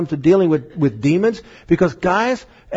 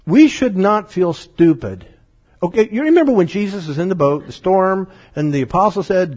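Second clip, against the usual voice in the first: usual speaking voice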